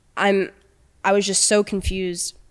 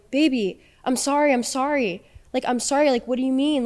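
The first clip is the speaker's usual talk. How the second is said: slightly higher, lightly mimicked pleading; imitated pleading apology; 5 semitones higher than usual. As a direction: mimic a boyfriend pleading, pitched up a little. Imitates boyfriend's sudden apologies.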